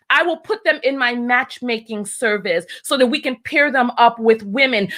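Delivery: ordinary delivery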